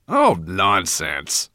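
slow, loud dismissal; big pompous dragon scoffing; scornful. A narrator's reading. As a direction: wave it off with drawn-out scorn. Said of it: "stout dragon scornfully".

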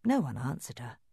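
ordinary delivery, nothing marked

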